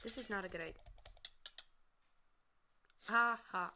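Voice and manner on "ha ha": mock laugh. Real, not acted. Triumphant in game.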